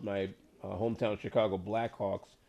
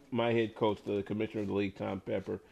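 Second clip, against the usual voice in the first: usual speaking voice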